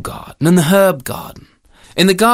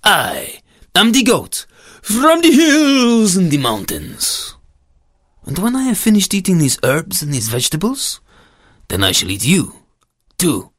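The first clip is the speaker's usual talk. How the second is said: boastful goat with stretched, swooping vowels; menacing storybook goat; 3 semitones higher than usual. Acted. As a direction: draw out the grand threat as a goat.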